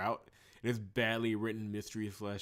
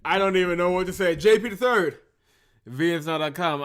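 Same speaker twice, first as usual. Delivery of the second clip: exasperated rant; 8 semitones higher, 12 dB louder than usual. Real, not acted. Ranting reaction to game.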